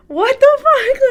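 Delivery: very high, loud retold shock; reenacted shriek of disbelief; stunned disbelief. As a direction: blurt it in astonishment, pitch shooting up. Retelling stunned reaction to son's word.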